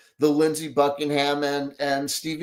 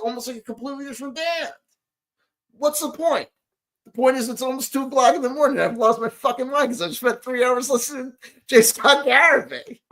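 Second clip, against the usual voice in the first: exasperated rant; 8 semitones higher than usual. Real, not acted.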